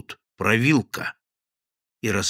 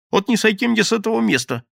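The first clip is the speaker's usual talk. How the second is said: high, loud frantic swearing; desperate oath; 10 semitones higher, 4 dB louder than usual. Acted. Thief swears to the gang he tells the truth. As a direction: swear it on your life.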